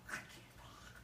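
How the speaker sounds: voiceless, faint whisper; calling in sick to the boss, whispering; laryngitis whisper. Retold or imitated. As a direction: whisper like you've lost your voice.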